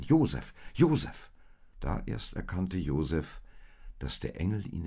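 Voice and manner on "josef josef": high, loud, pleading calls; raised, insistent appeal; urgent calling. A narrator's reading. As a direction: call his name with rising urgency. Maria urges Josef to flee.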